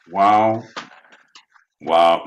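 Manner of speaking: ordinary talk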